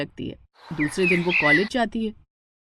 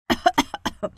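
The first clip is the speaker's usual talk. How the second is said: deliberate throat-clearing cough; pointed cough. A narrator's reading. Shivani walking in on the two, pointed cough. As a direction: cough to interrupt the pair. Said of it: "Shivani खांसते हुए कहती है".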